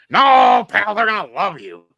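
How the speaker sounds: loud, high opening cry, then emphatic insistence; acted boss insisting. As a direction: overrule him with hammed-up certainty. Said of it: "cause he said".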